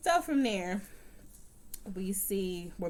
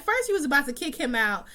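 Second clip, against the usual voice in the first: indignant rant; 7 semitones higher, 6 dB louder than usual. Real, not acted. Ranting at the pregnant character.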